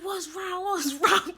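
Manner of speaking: high-pitched imitation of a worried mother; alarmed mom. Mom answering her freaking-out daughter, alarmed. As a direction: imitate mom asking urgently, voice way up. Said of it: "And she like".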